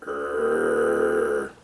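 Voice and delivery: low, sustained imitated growl; monster growl. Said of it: "somebody's growling on the radio".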